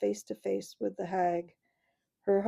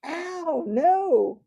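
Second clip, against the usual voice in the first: much higher, loud yelp; bellowed; 9 semitones higher, 8 dB louder than usual. Acted. Dwarf yells at the boy stepping on him, loud. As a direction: bellow it in pain. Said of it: "bellowed Trumpkin".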